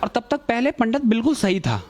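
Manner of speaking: usual speaking voice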